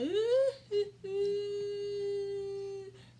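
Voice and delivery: long, high, loud pretend sob; mock crying wail. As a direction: wail in exaggerated tears. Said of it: "I was just crying".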